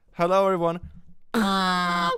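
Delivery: high, loud fake-cheery greeting; mock apology opener. Creator opens apology video with a high, loud greeting. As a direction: greet fans too brightly.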